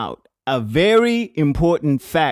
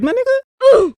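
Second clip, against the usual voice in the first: shocked call; 7 dB louder, slower than usual. Acted. Shocked calling to fallen friend.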